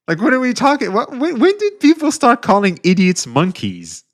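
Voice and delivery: high, loud cartoonish mock voice; hammed-up high falsetto bit; mocking silly voice. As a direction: play a squeaky baffled goof. Mock voice reacting to clip.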